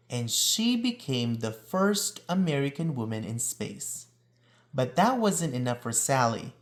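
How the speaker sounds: ordinary delivery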